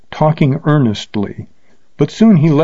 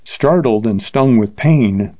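usual speaking voice

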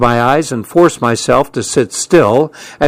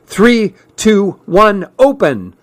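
excited countdown; 8 semitones higher, slower than usual. Acted. Friend's big reveal countdown.

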